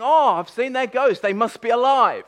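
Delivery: loud, high mock excitement; hammed-up excited deduction; mock realisation. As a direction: act out a silly, delighted conclusion, loud and high. Mock reaction to seeing a ghost.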